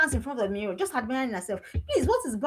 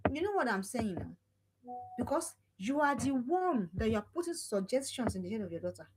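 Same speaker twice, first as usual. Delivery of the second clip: normal talking voice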